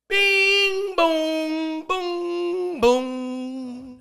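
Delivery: high, sung doorbell tones; imitated door chime. Comedian mimicking the ring at his door, sung chime. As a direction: ring out long, loud held notes. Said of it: "I hear".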